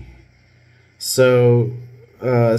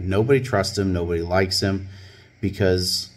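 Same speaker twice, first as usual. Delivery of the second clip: normal talking voice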